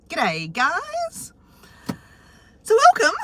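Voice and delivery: high squeaky Aussie elf puppet; chirpy Australian puppet greeting. Pretend.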